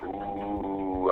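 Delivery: long, soft drawn-out "ooooh"; mock taunting group "ooh"; crowd "ooh". Poker table reacting to his summons, long "ooh". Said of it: "everybody at the poker table was like".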